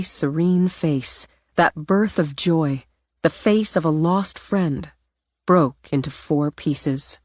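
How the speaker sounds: ordinary delivery, nothing marked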